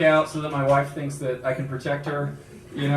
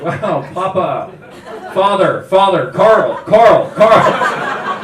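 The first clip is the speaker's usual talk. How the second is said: put-on louder and louder, calling Carl like a kid; calling out for attention; 5 semitones higher, 12 dB louder, much slower than usual. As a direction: call out to the big guy, trying to get his attention. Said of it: "getting his attention".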